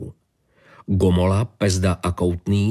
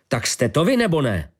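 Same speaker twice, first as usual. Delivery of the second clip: much higher, forceful demand; thundering; 7 semitones higher than usual. A narrator's reading. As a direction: thunder down at him. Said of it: "zahřímal nad ním kapitán Ožana". (hřmění, výrazně výš)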